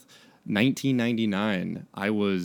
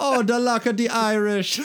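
higher, louder mock Jamaican lilt, laughing through it; hammed-up Caribbean accent; 12 semitones higher, 4 dB louder than usual. Mike's Irish line redone in a laughing mock Jamaican accent. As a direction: overplay the accent as a joke, giggling. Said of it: "why is he Jamaican".

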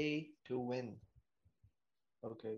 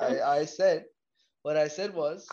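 insistent, loud; 8 dB louder than usual. Real, not acted. Co-host insists loudly.

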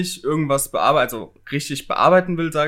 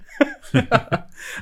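high-pitched laugh; 11 semitones higher than usual. Real, not acted. Laughs at own iMovie remark.

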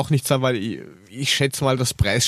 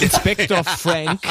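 announcer voice; 5 semitones higher, slower than usual. Acted. Jingle intro, announcing a co-host.

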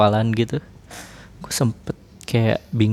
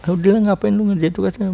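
mocking scold; 9 semitones higher than usual. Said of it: "terus gua bilang kayak".

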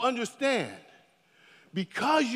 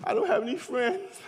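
put-on softer, whiny complaining teen; sulky teenager; 6 dB quieter than usual. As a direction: grumble like an upset teenager. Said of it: "sometimes teenagers get upset".

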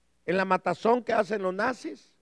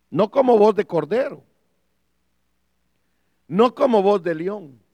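loud, punched denial; forceful preacher's declaration; emphatic preaching; 6 dB louder than usual. Real, not acted. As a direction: drive home each contrast. Emphatic preaching contrast about the voice.

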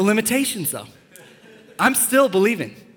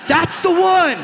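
louder high-pitched, over-the-top infatuation; smitten teenager; 10 semitones higher, 4 dB louder, slower than usual. Acted. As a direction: insist like a lovestruck teen, voice shooting up. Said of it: "you're like".

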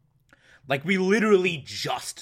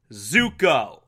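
ordinary delivery

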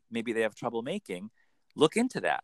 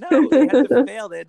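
laughing; 12 semitones higher, 13 dB louder than usual. Real, not acted. Laughs while answering.

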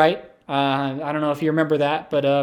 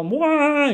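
high cartoon wail down a hole; Mario death wail; 11 semitones higher than usual. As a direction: imitate a game character dropping into a pit. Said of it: "He'd fall into a hole".

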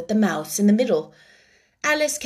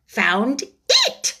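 crossly. A narrator's reading. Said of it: "the mouse replied rather crossly".

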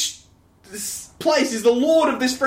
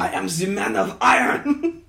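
a lightly put-on French accent. Joking Iron Man line to a friend, mild French accent. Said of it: "that French accent was".

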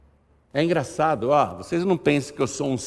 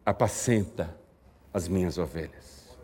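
hushed command; 10 semitones lower, 8 dB quieter than usual. Acted. Jesus' command voiced softly.

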